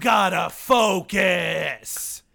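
slow, stretched angry yell; over-the-top tough-guy bark; drawn-out shout. Acted. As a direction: bellow every word out slowly. Crew member yelling at driver.

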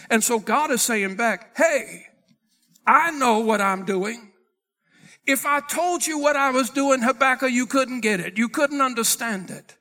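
loud, driving sermon delivery; forceful preaching. Acted. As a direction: proclaim God's answer with force.

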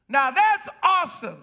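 ordinary delivery

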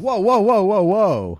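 high startled whoa, whoa; alarmed whoa. Acted. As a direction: pull back in alarm. Acted cannibal backing off.

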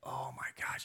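breathless hushed oh my gosh; a quiet gasp of realisation; whispered awe. Retold or imitated. Pastor recalling worship moment, whispered awe. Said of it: "I'm like".

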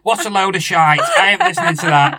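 loud sneering troll rant, hammed-up; mock Twitter troll. Podcaster mocks Twitter trolls, loud sneering rant. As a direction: rant like an angry keyboard warrior. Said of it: "You know what Twitter's like".

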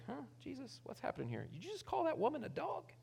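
hushed, incredulous questioning; softly puzzled, pitch leaping on the question. Acted. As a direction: query it quietly, as if taken aback. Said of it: "makes that one eyebrow go up like".

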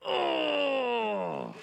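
a sudden hurt "Oh!" from behind; startled cry, mildly acted; retold pained cry. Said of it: "I just hear behind me".

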